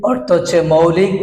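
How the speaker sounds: ordinary delivery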